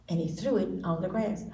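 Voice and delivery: ordinary delivery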